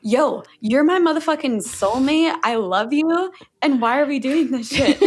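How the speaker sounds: lightly voiced soul, loud and fond; the soul cutting in with loving exasperation; soul speaking. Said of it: "my soul comes in and is like".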